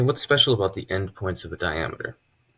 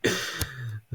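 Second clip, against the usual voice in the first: soft laugh; 6 semitones higher, 5 dB quieter than usual. Real, not acted. Laughs at own story.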